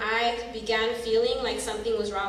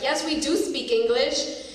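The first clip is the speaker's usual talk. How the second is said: retold yell; 4 semitones higher than usual. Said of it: "my immediate reaction was to yell at him".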